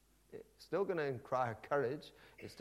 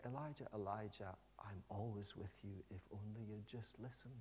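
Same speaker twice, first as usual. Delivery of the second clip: tender; 2 semitones lower, 9 dB quieter than usual. Acted. God speaking tenderly to Elijah.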